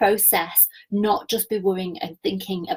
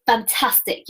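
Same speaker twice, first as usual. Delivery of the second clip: ordinary talk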